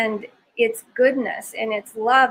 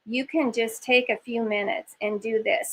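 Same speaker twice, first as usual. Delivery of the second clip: usual speaking voice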